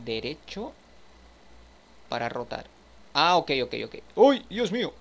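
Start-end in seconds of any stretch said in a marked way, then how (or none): 4.2-4.9 higher and louder, a sudden startled yelp; startled cry. Real, not acted. Gamer startled when the furniture goes wild, yelping. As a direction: jump in fright.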